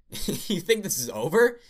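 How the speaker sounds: slow, high, loud, cracking menace; broken voice. Acted. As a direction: let the voice break apart, slow and high. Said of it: "he said with a broken voice".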